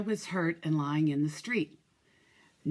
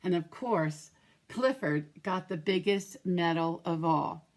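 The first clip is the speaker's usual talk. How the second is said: usual speaking voice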